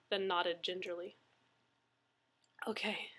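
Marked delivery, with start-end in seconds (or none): 2.7-3.1 a faint, breathless whisper; whispered okay. Acted. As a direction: barely get the word out, drained. Shaken man answers in whisper.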